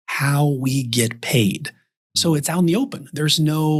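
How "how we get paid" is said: "how we get paid" slowly, each word distinct; clearly spoken. Shown as a demonstration. Advisor making fees clear to client, slow and clear. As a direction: spell out HOW WE GET PAID at half speed. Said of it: "to clearly hear the words".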